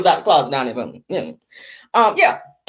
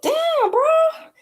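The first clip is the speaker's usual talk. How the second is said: amazed. Retold or imitated. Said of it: "I was like".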